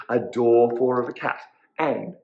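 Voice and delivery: plain reading voice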